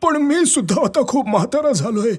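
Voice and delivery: put-on high, thin old man's voice; old Ramu. Aged Ramu asks the tree for a boat, high old voice. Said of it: "रामूने सांगितलं".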